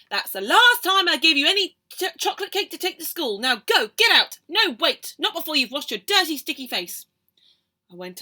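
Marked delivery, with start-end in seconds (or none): 0.1-7.1 loud stern scolding; louder cross mum; telling off. Acted. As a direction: send the child off sternly.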